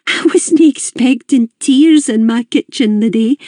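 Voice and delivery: high, kindly Glaswegian mum voice; high, curious mother's voice; Mrs Gibbons. A narrator's reading. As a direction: voice the chatty older Scottish hostess, pitched high.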